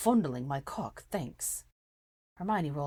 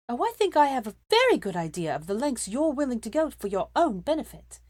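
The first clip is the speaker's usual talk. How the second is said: pointed; 8 semitones higher, 7 dB louder than usual. A narrator's reading. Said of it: "she said, casting a pointed glance".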